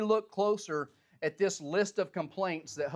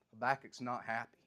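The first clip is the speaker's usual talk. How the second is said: ordinary talk, nothing marked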